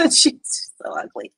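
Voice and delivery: slow whispered aside; slow, breathy catty whisper. Real, not acted. Catty aside after laughing.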